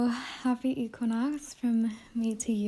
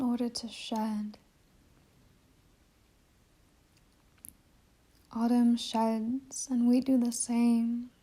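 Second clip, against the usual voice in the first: guided meditation. Acted. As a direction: guide listeners inward, unhurried, letting each phrase settle.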